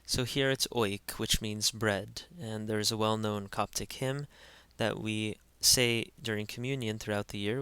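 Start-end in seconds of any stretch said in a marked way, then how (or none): none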